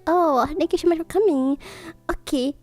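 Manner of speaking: usual speaking voice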